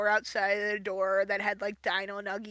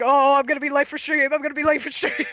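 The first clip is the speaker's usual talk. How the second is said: mock frantic fretting, higher and louder; retold panic; 4 semitones higher, 8 dB louder, faster than usual. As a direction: relive the panic, laughing as it breaks up. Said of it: "literally in the car, shaking".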